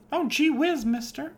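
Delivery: put-on squeaky high little-girl voice; wholesome 1950s kid. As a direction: play a sweet innocent small girl. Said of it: "woman who was acting eight".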